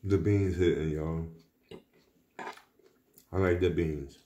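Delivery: chewing while talking; talking between bites; mouth full. Real, not acted. Seen 0: eating from plate with fork, mouth working between words.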